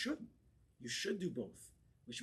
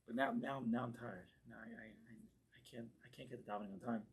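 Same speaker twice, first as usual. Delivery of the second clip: soft, low, faltering complaint; weary excuse-making; 3 semitones lower, 6 dB quieter than usual. Acted. Rabbi acting a worn-out man who can't pray, weary and quiet. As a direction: mumble tired excuses, trailing away. Voices a tired man's excuses.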